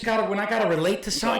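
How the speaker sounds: usual speaking voice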